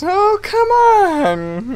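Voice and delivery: high, drawn-out come on; long, high groan of frustration; exasperated. Real, not acted. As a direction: plead with the game, slowly. Exasperated at game.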